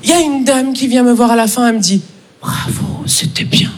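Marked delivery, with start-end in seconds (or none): 2.4-3.7 lady whisper. Retold or imitated. Lady after the show.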